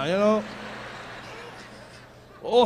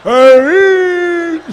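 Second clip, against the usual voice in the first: auntie's call; 5 semitones higher, 8 dB louder, much slower than usual. Retold or imitated. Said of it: "she all doing all this shit here".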